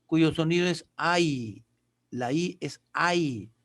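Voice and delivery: ai said slowly as a gliding ai; slow down on ai, the English alphabet I; La I es ai, a clear glide from a to i; English letter I. Shown as a demonstration. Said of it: "adquiere el sonido del abecedario inglés".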